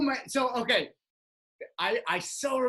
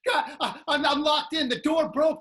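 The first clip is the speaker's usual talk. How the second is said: higher, louder mock distress; imitating panic; 7 semitones higher, 7 dB louder than usual. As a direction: play the trapped friend, a touch frantic. Friend locked in, panicking.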